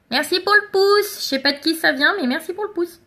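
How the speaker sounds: high-pitched, chirpy thank-you; cheerful thanks. Real, not acted. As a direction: call out thanks brightly to a viewer. Calls out thanks to live viewer.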